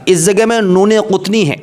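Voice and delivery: ordinary delivery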